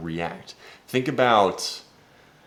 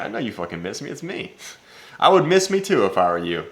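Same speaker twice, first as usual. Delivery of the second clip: confident brag, pitched up at the end; cocky confidence; 3 semitones higher, 6 dB louder than usual. Retold or imitated. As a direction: toss it back full of self-assurance. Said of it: "your confidence needs to look like right there".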